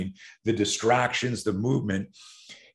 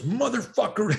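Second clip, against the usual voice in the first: mock teen curse; 11 semitones higher than usual. Upset kid recalls bully attack.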